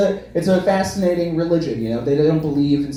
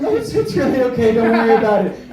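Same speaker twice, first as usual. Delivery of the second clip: high, over-accommodating Midwesterner; 7 semitones higher, 3 dB louder than usual. Acted. Midwesterner smoothing over a bad haircut, high and falsely okay. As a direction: reassure in a high, apologetic Midwestern voice. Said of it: "That's my Midwestern voice".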